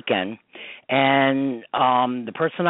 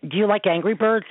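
higher, lightly imitated child asking; a hint of a curious kid; little boy voice; 8 semitones higher than usual. Mother retelling the boy's question, lightly voiced higher child. Boy asks her about game.

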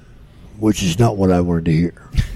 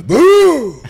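much higher, loud hammed-up boo; mock boo; 11 dB louder than usual. Andre jumping up in the crowd to scare fans, shrill loud mock boo. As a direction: leap out with a big high-pitched boo. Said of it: "he'd jump up and go".

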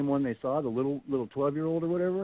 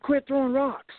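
much higher, irritated retort; annoyed; slower than usual. Retold or imitated. Les scolding friends he thinks threw rocks, high annoyed. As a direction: snap at your buddies to knock it off.

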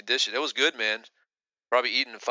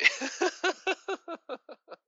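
high burst of laughter. Real, not acted. Laughing at Master Ken memory.

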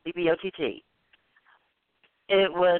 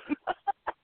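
high laugh; 12 semitones higher, 5 dB quieter than usual. Real, not acted. Laugh after Paul impression.

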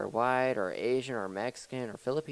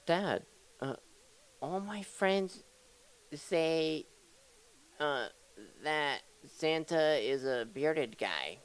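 higher, halting mock kid voice; imitating own kid; 3 semitones higher, slower than usual. Kid telling dad what friends say, in a hesitant child voice. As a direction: play the kid, haltingly putting a doubt to dad. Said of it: "my kid's like".

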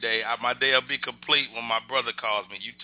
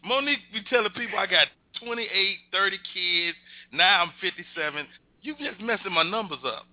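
high playful complaint; 7 semitones higher than usual. Real, not acted. Friend teases about his kids.

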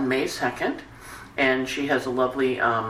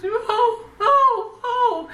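very high imitated kitten wail; little high-pitched mewling calls; crying kitten; 5 dB louder, much slower than usual. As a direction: mimic a kitten's plaintive cries. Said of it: "Ted's crying for me".

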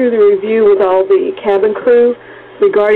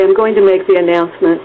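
normal talking voice

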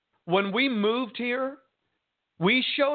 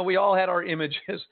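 usual speaking voice

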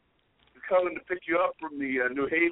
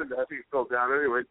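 normal talking voice

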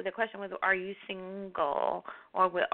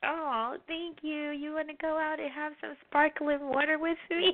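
higher-pitched, coy mock come-on; playful flirting; 6 semitones higher than usual. Host jokingly asks the guest out after a compliment, playfully flirty. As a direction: play up a flirty thank-you and invitation.

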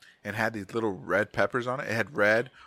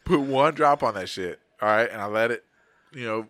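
usual speaking voice

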